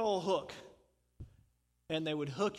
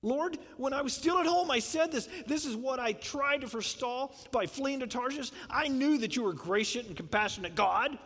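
higher, whiny complaining Jonah; attitude; 5 semitones higher than usual. Retold or imitated. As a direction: gripe at God like a sulking prophet. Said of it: "he kinda had an attitude with God".

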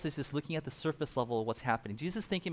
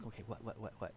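low, much softer rapid whats; hushed eager asking; 6 semitones lower, 15 dB quieter, faster than usual. Retold or imitated. Disciples eagerly asking Jesus for the secret, hushed. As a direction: lean in, hungry for the secret.

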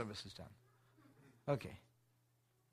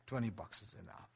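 grudging husband; 4 semitones lower, 8 dB quieter than usual. Retold or imitated. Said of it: "The husband says".

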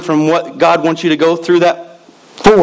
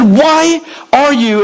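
incredulous why; 9 semitones higher, much slower than usual. Retold or imitated. Coworker's slow incredulous question.

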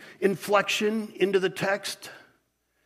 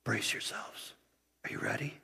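whisper; 8 semitones lower, 13 dB quieter than usual. Acted. Preacher hushes congregation before reading.

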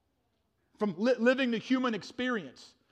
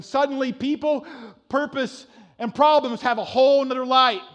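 building to a shout; 3 semitones higher, 7 dB louder than usual. Real, not acted. Building climax of sermon.

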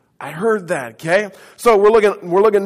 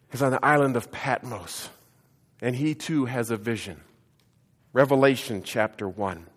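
normal talking voice

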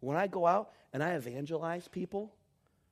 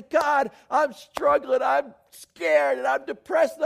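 much higher and louder, slow, pleading; anguished plea; 12 semitones higher, 12 dB louder, slower than usual. Acted. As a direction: cry out to God in distress. Pastor voices his own plea.

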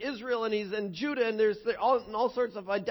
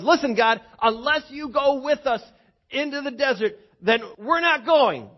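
loud, raised plea, pushing hard; bold demand; 6 semitones higher, 8 dB louder than usual. Acted. Moses bluntly telling God he won't go. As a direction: insist like a stubborn leader.

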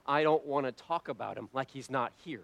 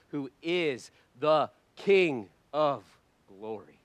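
usual speaking voice

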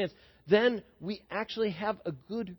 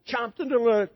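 ordinary talk, nothing marked

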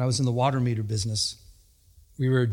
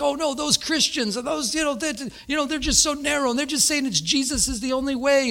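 high-pitched mimicry of disapproving critics; mock dismay; 11 semitones higher than usual. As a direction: imitate critics fretting in mock horror.

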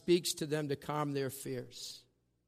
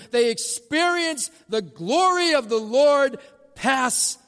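higher, louder preaching crescendo; preaching at full force; 8 semitones higher, 8 dB louder than usual. Acted. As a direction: proclaim the glory with rising force. Preaching climax on the glory.

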